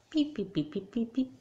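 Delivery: short, high dialling beeps; imitated phone beeps. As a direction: voice the phone keys as quick beeps. Imitates phone keys being dialled.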